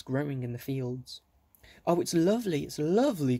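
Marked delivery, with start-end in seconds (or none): 1.9-3.4 high, bright happy cry; joyful. A narrator's reading. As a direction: delight spilling over while dancing. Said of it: "cried Beth, dancing around joyfully".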